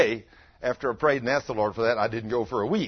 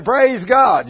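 loud praise; 10 semitones higher, 13 dB louder than usual. Shown as a demonstration.

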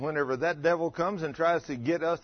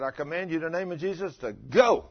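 commanding the devil; 4 semitones higher, 4 dB louder than usual. Retold or imitated. Casting out a demon.

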